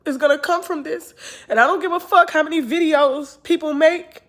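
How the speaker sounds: high, forceful and emotional; fired-up confession; impassioned defiance. Real, not acted.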